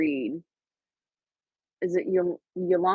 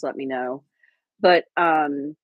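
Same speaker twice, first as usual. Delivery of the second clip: usual speaking voice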